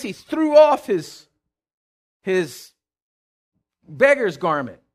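ordinary delivery

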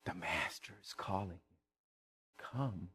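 very soft, low, reverent-sounding call; hushed summons. Acted. Crowd walking over to the beggar, hushed and coaxing. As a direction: drop to a tiny, low voice.

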